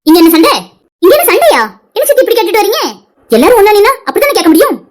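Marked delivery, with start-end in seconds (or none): none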